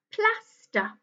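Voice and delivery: "Plaster" high, in two counted beats; syllable counting. Shown as a demonstration. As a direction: count out "Plaster", PLAS high, then TER. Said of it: "Let's count the syllables".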